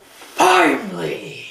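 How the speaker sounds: slow, whispered The Rock line. Retold or imitated. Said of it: "I was quoting The Rock".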